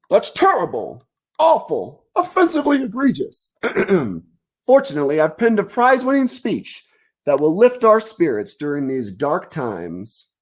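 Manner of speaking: high, grandiose cartoon politician; pompous mayor. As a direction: orate self-importantly to the crowd.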